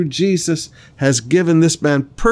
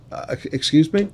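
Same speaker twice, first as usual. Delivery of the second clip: incredulous; 4 dB quieter than usual. Pretend. Voicing the astonished crowd.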